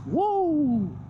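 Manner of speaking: joyful high whoo mid-ride; excited whoop. Real, not acted. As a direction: let out a happy whoop. Rider whoops while riding.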